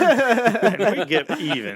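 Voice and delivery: mock menace. Mock threat to haters.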